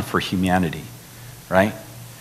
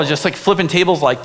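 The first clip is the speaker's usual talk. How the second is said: usual speaking voice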